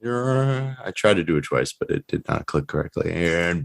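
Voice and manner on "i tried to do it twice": normal talking voice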